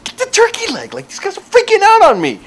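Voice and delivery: very high, frantic retold yell; mock panicked yell. Counter lady yelling at her coworker, mock high-pitched yell. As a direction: imitate a rattled worker yelling for help. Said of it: "the lady's yelling at her coworker".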